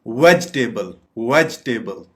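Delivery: "वेजिटेबल" said VEJ-tay-bul, no i; correct pronunciation. Shown as a demonstration. Teacher modelling the word, "वेजिटेबल" as VEJ-tay-bul. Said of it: "इसकी दुरुस्त pronunciation है".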